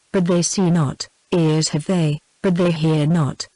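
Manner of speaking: ordinary delivery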